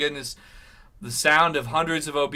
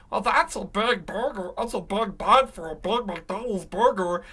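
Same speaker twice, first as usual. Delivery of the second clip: mocking impression; 5 semitones higher than usual. Mocking the presenter's voice.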